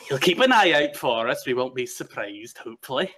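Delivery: eager Nyctis. Acted.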